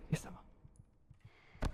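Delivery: breathless whisper. Real, not acted. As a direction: whisper so it won't hear. Tense moment hiding.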